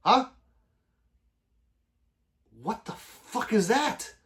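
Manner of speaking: high, slow, incredulous question; drawn-out mock confusion; baffled. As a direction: act out total disbelief. Retelling confusion at pansexual.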